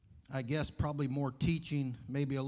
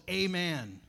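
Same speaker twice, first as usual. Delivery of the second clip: drawn-out mock yell, moderately raised; retold yell; 3 semitones higher than usual. Rider remembering the stop word at cliff, mock yell. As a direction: imitate a last desperate call to the horse. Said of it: "At the top of his lungs, he yells".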